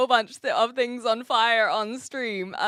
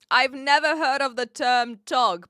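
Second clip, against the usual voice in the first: ordinary talk, nothing marked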